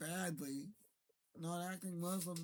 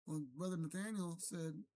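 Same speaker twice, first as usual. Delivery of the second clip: ordinary talk, nothing marked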